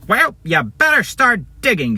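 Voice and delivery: high, mocking henchman voice; annoying bad-guy voice. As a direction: taunt in a high, irritating put-on voice. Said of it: "Guy one's annoying voice".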